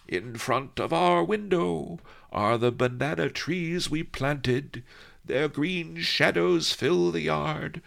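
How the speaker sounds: put-on high, loud, slow old singer; crazy old voice drifting on the wind; old cracked crazy voice. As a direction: quaver it out like a mad old poet. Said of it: "old, cracked, crazy voice".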